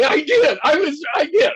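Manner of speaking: loud laughing admission, half crying. Real, not acted. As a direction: caught out and laughing loudly. Teased by host, laughing defensively.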